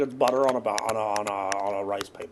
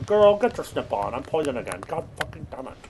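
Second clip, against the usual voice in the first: ordinary talk, nothing marked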